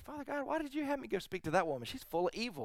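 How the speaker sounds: high, fast, exasperated plea to God; a hint of an exasperated older lady; voiced friend. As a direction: pitch up as the friend. Pastor voices friend's complaint to God.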